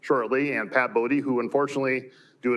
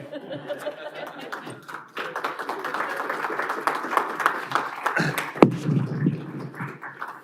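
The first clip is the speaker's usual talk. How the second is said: laughing. Real, not acted. Bursts out laughing at mistake.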